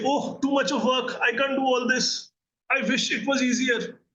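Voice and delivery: high, slow, self-pitying whine; whiny victim voice. Retold or imitated. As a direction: moan it like someone who has given up. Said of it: "This is the victim version of you".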